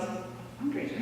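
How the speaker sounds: breathy, soft incredulous repeat of "fundraiser", falling; scoffing echo. Retold or imitated. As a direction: toss "A fundraiser?" back as if it's absurd, half-voiced. Teacher scoffs at kid's idea.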